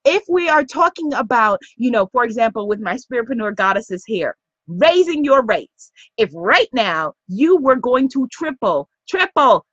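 normal talking voice

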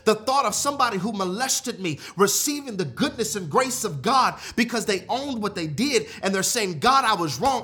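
impassioned preaching tirade, leaning hard on key words; angry Jonah. Acted. Preacher voices Jonah railing at God, angry and impassioned. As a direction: protest to God with mounting force. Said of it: "Jonah was saying".

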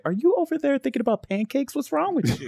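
put-on high disbelief at a drunk girl; incredulous quote. Host asking a drunk contestant in high disbelief.

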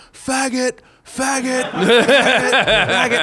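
high, loud repeated yell; yelling heckler. Retold or imitated. Drunk heckler yelling at the comic during the set, high. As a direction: bark it over and over from the crowd. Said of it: "he was just yelling".

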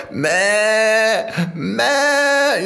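high, loud mock bleating; sheep bleat. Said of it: "He goes".

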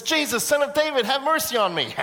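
high, urgent call across a distance; crying out. Acted. Blind Bartimaeus calls to Jesus within hearing distance, crying out. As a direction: call out to be heard in the crowd. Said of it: "And then he cries out".

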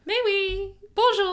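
high, playful fake French; mock French accent. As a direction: chirp it in a hammy French accent. Joking French after France.

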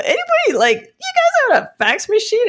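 high, awkward tentative question; high sheepish question to the boss; sheepish ask. Retold or imitated. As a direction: ask it hoping nobody notices. Sheepishly asking CEO.

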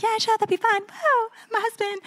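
retold agreement in a high, light voice; high breezy reply. Wife agreeing to honeymoon tennis, high retold voice.

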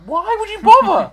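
high, loud disbelief; incredulous. Retold or imitated. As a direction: squeak it out in disbelief. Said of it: "and I'm like".

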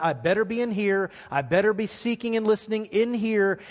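usual speaking voice

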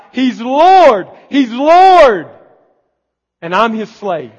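loud, high cry of praise; screaming out. Acted. Spirit in a believer crying out praise, shouting. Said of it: "that screams out".

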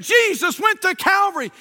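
imitated pulpit proclamation, pitched high; preacher proclaiming. Retelling what the preacher told a new listener, imitated high proclamation. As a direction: lift it up like a preacher declaring news. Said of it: "And the preacher said".

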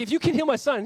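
high, loud pleading demand; pleading father. Retold or imitated. Desperate father demanding Jesus heal his son, loud. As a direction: press the request hard and high.

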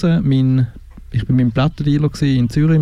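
ordinary talk

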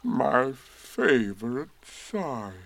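very slow, mournful musing; sad. A narrator's reading. Eeyore mourning his favourite balloon, sad. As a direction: linger sorrowfully on every word. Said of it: "he said to himself sadly".

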